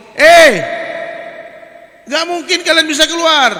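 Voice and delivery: high, loud mocking jeer; discouraging frogs. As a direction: taunt the frog stuck in the hole. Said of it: "dia mulai ngembosin, ngomongin". (ejekan kodok dengan suara tinggi dan keras)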